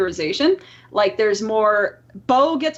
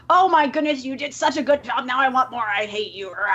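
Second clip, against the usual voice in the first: exaggerated mock hate. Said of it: "in my exaggerated way".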